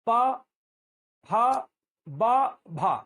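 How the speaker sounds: प फ ब भ each said alone with a pause, loud, lips popping; model lip consonants. Shown as a demonstration. Teacher asks the class to repeat: प फ ब भ, clear and loud. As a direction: drill प, फ, ब, भ with strong puffs of air. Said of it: "बोलिए".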